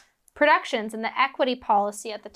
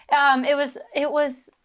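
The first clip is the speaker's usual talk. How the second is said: ordinary talk, nothing marked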